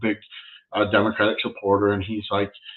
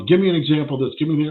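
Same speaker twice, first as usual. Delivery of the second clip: higher, louder mimicked badgering; demanding; 6 semitones higher, 6 dB louder, faster than usual. Retelling a bar ranter demanding examples, pushy. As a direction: imitate a pushy man firing off demands.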